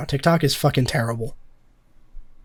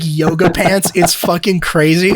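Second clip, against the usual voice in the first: normal talking voice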